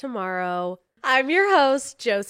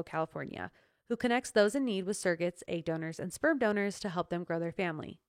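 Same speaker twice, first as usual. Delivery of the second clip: steady, even sponsor read; 2 semitones lower, 5 dB quieter than usual. Acted. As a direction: read the ad smoothly. Reads sponsor ad.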